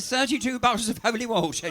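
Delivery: high, loud incredulous echo; disbelief. Retold or imitated. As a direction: repeat it like you can't believe it. Officer repeats her claim in disbelief.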